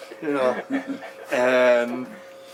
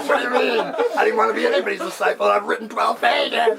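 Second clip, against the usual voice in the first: higher, louder laughing panic; freaking out; 10 semitones higher, 3 dB louder than usual. Retold or imitated. Inner mind panicking at the guru's question, high laughing protest. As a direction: rattle it off in a half-laughing panic. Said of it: "my mind's freaking out".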